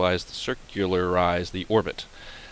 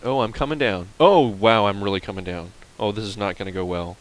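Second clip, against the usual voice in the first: usual speaking voice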